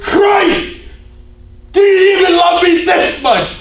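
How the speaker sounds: high, loud, furious scream at Christ; retold furious shout; angry shout. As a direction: hurl it at him in rage. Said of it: "she got really angry".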